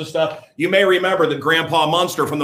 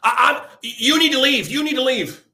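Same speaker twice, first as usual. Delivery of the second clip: much higher, stammering, whiny voice; mocking high whiny voice; 10 semitones higher than usual. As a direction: mimic a flustered shopper, pitched high. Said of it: "some little Obama voter".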